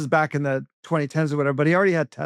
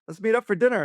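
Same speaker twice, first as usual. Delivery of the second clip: eager mayor; 8 semitones higher than usual. Retold or imitated. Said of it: "And he's like".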